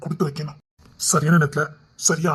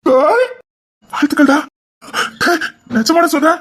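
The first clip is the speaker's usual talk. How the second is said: loud, high whoop of congratulation; overjoyed; 10 semitones higher, 7 dB louder than usual. Acted. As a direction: burst out with joy at the news. Overjoyed at commissioner news. (மகிழ்ச்சியில் உரத்த குரல்)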